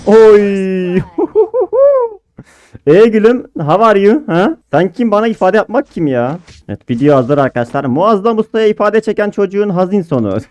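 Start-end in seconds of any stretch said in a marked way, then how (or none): 0.1-2.1 high squealing laugh; gleeful loud laugh. Real, not acted. Laughing at kill. 2.9-6.4 high, loud teasing jeer; higher cocky sing-song taunt; mock taunt. Taunting the beaten opponent.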